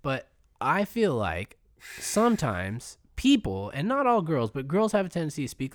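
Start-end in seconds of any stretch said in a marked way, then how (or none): none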